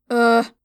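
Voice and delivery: loud short grunt; pained grunt. Acted. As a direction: grunt after hitting head. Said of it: "I grumbled".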